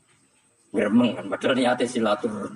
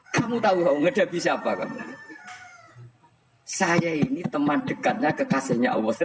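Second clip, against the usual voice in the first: a hint of Umar snapping, pitched higher; half-playful, raised-pitch scolding as Umar; 7 semitones higher than usual. Umar scolding Munkar Nakir in the grave, mildly sharp, raised pitch. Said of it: "Umar ngewatek".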